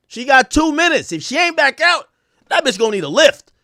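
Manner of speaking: rising excitement in the verse; heated lyric line. Acted. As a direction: hype this line up like the story's peak. Climax of the recited story.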